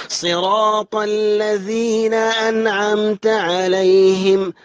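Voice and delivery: slow chant, unnasalised n in أنعمت; clear nun. Shown as a demonstration. Teacher shows izhar, slow chant with clear n in أنعمت. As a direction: recite slowly, clean n before ayn in أنعمت. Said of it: "وهنا حكمه الإظهار".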